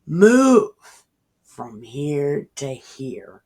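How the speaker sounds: telling a mountain to move, slow and firm; slow command. Acted. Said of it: "you will say to this mountain".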